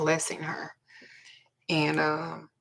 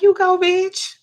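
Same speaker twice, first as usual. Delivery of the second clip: high, loud cheer; cheering her on; 13 semitones higher, 10 dB louder, slower than usual. Retold or imitated. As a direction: whoop it out like a proud friend. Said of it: "I was like".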